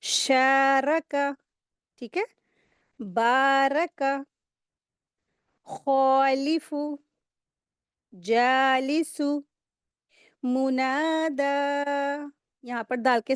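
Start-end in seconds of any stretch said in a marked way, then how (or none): 0.0-1.3 शारका with रा heavy and long, का quick. Shown as a demonstration. Teacher modelling for students: heavy रा and quick का. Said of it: "रॉ को heavy करेंगे, काफ को जल्दी पढ़ेंगे". 3.0-11.5 very slow drawn-out recitation of example words. Shown as a demonstration. Teacher to class, reading example words: slow drawn-out recitation.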